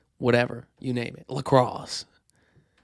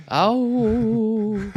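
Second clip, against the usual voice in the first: ghostly howl; 10 semitones higher, 6 dB louder than usual. Pretend.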